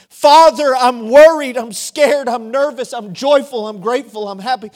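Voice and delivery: loud, high outpouring, easing toward the end; full-voiced preacher's prayer, pitched high; impassioned cry to God. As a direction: call out fervently, as if praying on your knees. Acted crying out in prayer.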